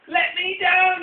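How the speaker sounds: high, loud cry to be let down; shout. Acted. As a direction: yell it out, scared and high. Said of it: "he shouts".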